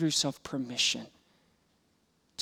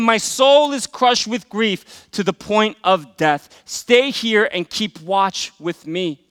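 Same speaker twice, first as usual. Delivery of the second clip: slow anguished quote; 6 semitones higher, 9 dB louder, slower than usual. Acted. Jesus's anguished words, slowed.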